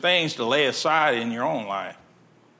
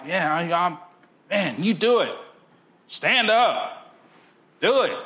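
cheering on; 5 semitones higher than usual. Retold or imitated. Cheering on the pastor.

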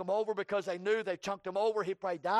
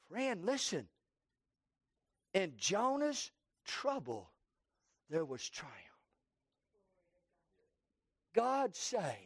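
quiet appeal; 5 semitones lower, 6 dB quieter than usual. Acted. Preacher appealing to a friend in the congregation, quiet. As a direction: lean in and speak it gently.

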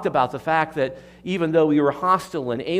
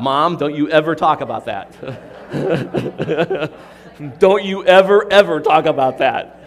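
playful scolding through laughter, louder; laughing mock warning; 2 semitones higher, 10 dB louder than usual. Real, not acted. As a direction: tease mom, half laughing. Teasing his laughing mother.

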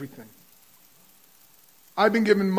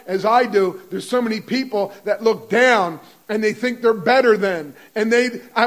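preaching with fervour; 2 semitones higher, 6 dB louder than usual. Acted. Preacher exhorting the congregation.

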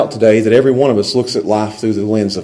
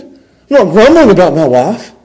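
raised, louder protest; mock grumbling; 7 semitones higher, 5 dB louder than usual. Shown as a demonstration. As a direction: push the voice up and louder. Contrasts grumbling with thankfulness.

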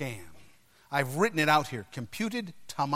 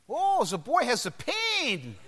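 high, slow mock German accent; Doctor Hans Schein; 6 semitones higher, slower than usual. German doctor discovering the boy is hurt, thick German accent. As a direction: draw it out as a caricatured German physician. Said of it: "He had a thick German accent".

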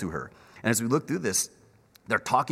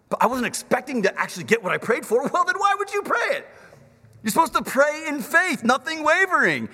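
higher, louder playful debating voice; playful back-and-forth; 11 semitones higher, 4 dB louder than usual. Retold or imitated. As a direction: tease both sides of the argument. Acted dialogue with a believer.

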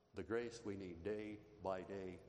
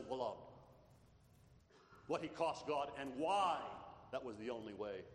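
impassioned preaching; 7 semitones higher, 4 dB louder than usual. Acted. Preaching climax on Christ's cost.

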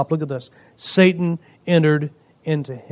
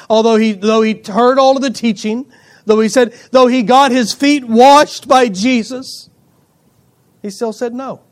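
building preaching; 5 semitones higher, 5 dB louder than usual. Real, not acted. Preacher building list of proofs.

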